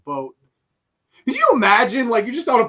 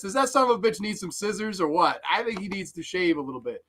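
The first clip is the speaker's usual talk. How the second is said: ordinary delivery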